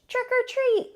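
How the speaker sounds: Emily at each door calling trick or treat, high sing-song. Acted. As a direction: call it out to the house. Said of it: "called Emily Elizabeth".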